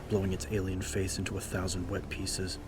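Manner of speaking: ordinary delivery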